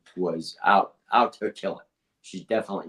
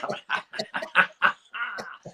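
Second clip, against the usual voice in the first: high breathy laugh; high giggle; 12 semitones higher than usual. Real, not acted. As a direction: giggle at the stumble. Laughing at own stumble.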